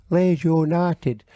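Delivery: put-on drawn-out Yorkshire accent chant; slow mock Yorkshire accent. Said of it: "accent actually comes from".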